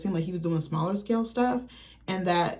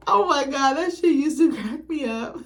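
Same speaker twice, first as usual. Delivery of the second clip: laughing; 6 semitones higher than usual. Real, not acted. Laughing at the cartoon clip.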